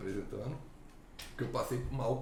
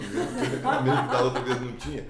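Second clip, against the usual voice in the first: laughing; 2 semitones higher, 8 dB louder than usual. Retold or imitated. Laughing retold complaint.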